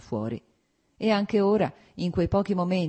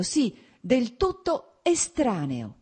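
slow, bitter and hurt; grieving resentment, each word drawn out; pain and rancour; 8 semitones higher, 3 dB louder, slower than usual. A narrator's reading. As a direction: lean slowly on the word estraneo. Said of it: "pronunziò la parola estraneo ... con pena e rancore".

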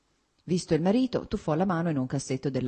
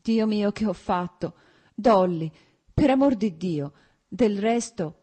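higher, louder anguished exclamations; choked-up pleading; 6 semitones higher, 6 dB louder than usual. A narrator's reading. Husband begging wife for God's sake, anguished. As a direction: plead desperately, on the verge of a sob. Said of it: "un singhiozzo gli si era fermato in gola".

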